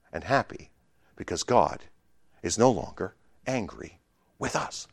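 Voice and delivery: soft and measured, letting each phrase land; gentle, quiet assurance; tender reassurance. Real, not acted. Tender turn to good news.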